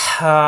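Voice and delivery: হা with a rush of breath from the throat; heavy pharyngeal হা, drawn out; hot-breath h. Shown as a demonstration. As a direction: push warm air through a long হা. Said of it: "গরম বাতাসের সাহায্যে উচ্চারণ".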